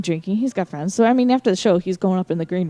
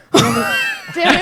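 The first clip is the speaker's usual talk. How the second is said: high, airy mock panic; flustered panic; 8 semitones higher than usual. To herself as the singer flirts, flustered. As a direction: squeak out a retold freak-out. Acting out flustered reaction to come-on.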